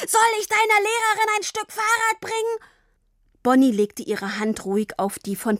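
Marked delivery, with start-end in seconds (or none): 0.1-2.6 very high, strained furious yell; bellowing. A narrator's reading. Father rages at daughter about money, bellowing. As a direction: bellow the question, pitched right up. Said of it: "brüllte er weiter".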